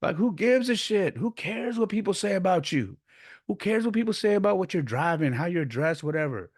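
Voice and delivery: high-pitched imitated granddad lecture; worked-up mock scolding; impassioned lecture. Acting out grandfather's fierce lesson.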